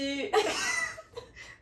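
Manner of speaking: high burst of laughter. Real, not acted. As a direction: break into laughter. Laughing mid accent attempt.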